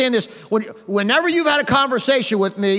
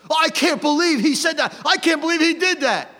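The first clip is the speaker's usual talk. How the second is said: high-pitched parody of indignation; mock outrage; 5 semitones higher than usual. Preacher mimicking upset people over politics, mock outraged voice. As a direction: imitate someone scandalised by the news.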